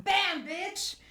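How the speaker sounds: high-pitched, punchy gotcha; mock triumphant outburst. Retelling her surprise attack on the Warlock, triumphant outburst. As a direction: land it as a sudden comic-book blow. Acting the character's sudden strike back.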